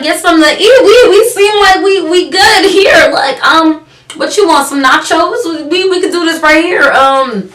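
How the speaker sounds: high, loud sarcastic sales pitch; animated, incredulous offer of what's on hand. Retold or imitated. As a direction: play up the food options with mock eagerness. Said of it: "so I'm really sarcastic".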